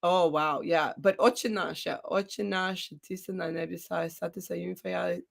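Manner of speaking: normal talking voice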